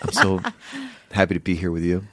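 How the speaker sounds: halting mock sincerity; imitated show contestant. Contestant to their date on the show, halting imitated sincerity. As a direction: lightly mimic a contestant, pausing mid-sentence. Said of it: "is the person going like".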